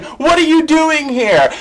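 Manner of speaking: high, slow incredulous call-out; mock yell. Vlogger imitates a red carpet host yelling at a guest, high and slow. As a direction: fling the question up high in disbelief. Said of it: "the highlight was him yelling".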